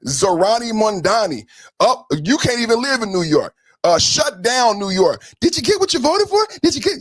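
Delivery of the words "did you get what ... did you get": high mocking taunt; sing-song jeer. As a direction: jab it at them up high. Taunting voters.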